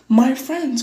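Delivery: loud high anxious cry; cried. A narrator's reading. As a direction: cry out in relief and worry. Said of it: "cried the elephant".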